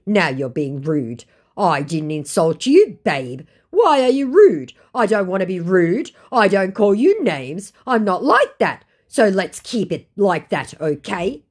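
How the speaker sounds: hammed-up wounded scammer; mock offended scammer. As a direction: act hurt like the scammer.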